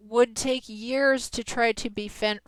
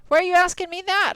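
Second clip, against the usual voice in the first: higher, louder, fast mock outrage; indignant surprise; 7 semitones higher, 7 dB louder, faster than usual. Real, not acted. Streamer to chatter asking a security question, scandalised and fast. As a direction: fire it back, scandalised. Reacting to an odd chat question.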